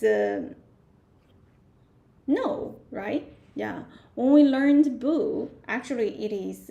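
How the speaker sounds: ordinary talk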